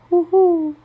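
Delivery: high soft owl hoo-hoo; gentle imitated owl call; owl hoot. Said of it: "the soft cries of the owl".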